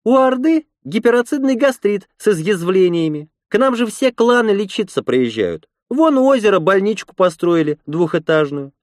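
high-pitched character voice for a woman; red-haired woman's voice. A narrator's reading. Red-haired woman tells visitors about the hospital, high woman's voice.

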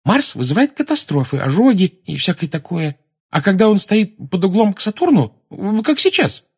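very high, loud schoolgirl chatter; excited. Acted. Parvati explaining what Mars causes, excited and high. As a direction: rattle off the knowledge eagerly, climbing high. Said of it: "возбуждённо сказала".

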